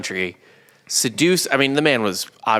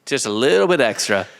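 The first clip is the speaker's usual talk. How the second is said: mock doctor; 7 semitones higher than usual. Acting the doctor bleeding patient.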